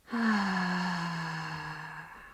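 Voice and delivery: long open-mouthed "ahh" sigh out; slow audible sigh, fading away; releasing sigh. Shown as a demonstration. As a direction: let all the tension go on one long exhale. Demonstrates sighing out to release tension.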